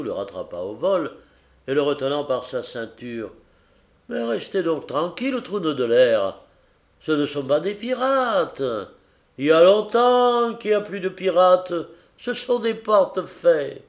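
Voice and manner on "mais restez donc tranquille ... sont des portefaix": raised, insistent scolding; exasperated captain. Acted. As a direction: set the panicking passenger straight. Capitaine retient et sermonne le héros. (capitaine agacé qui rabroue le héros)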